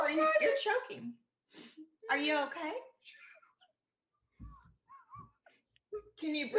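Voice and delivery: high alarmed voice; sudden worry, pitch shooting up. Real, not acted. Alarmed friend checks on her.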